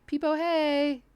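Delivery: a timid little hello; awkward greeting. Retold or imitated.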